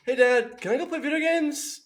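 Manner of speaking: put-on high, fast, sugary little-kid ask; innocent kid. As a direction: sweetly dodge the trouble. Kid asking dad innocently.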